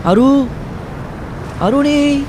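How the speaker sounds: frantic calling. Acted. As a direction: call his name desperately. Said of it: "त्यांनी हाका ऐकल्या".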